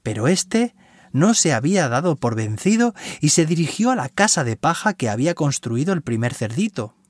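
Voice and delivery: ordinary delivery, nothing marked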